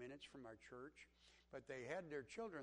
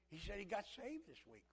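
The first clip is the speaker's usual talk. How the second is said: usual speaking voice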